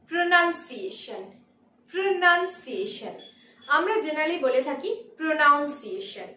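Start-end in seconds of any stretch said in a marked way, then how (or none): 0.1-3.1 "pronunciation" with second syllable "nun", slow and deliberate; correct pronunciation. Shown as a demonstration. Teacher introducing a commonly mispronounced word, correct "pronunciation" with "nun". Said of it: "The correct pronunciation is". 5.2-6.3 "pronunciation" with "noun" as second syllable; mispronunciation. Shown as a demonstration. Teacher showing what people generally say, "pronunciation" with "noun". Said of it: "That is incorrect".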